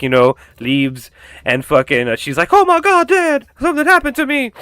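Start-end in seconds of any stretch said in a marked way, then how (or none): 2.5-4.5 very high mock girl's voice; panicked daughter. Girl telling her dad after the attack, high hammed-up panic. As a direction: squeal it like a frightened kid to Dad.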